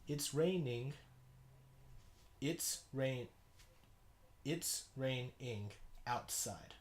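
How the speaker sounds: slow, separated syllables; measured, chopped-up haiku reading; syllable-by-syllable haiku count. Shown as a demonstration. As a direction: count out each syllable as you read. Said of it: "now that we know that it's a haiku".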